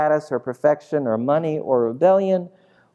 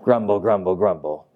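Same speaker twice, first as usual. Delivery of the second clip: very low mock mutter; low, lightly acted grumbling; 7 semitones lower than usual. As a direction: drop the pitch and grumble it. Mimics crowd's grumbling again.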